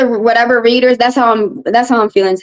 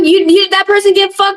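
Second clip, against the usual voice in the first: usual speaking voice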